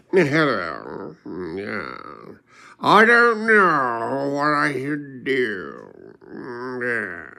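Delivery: lightly put-on odd man's voice, very slow; imitating a guy he met. A stranger telling [name] his troubles, slow, mildly put-on voice. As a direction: drawl the middle line out slowly like the strange guy. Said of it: "this is how he talked".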